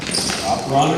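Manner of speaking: ordinary delivery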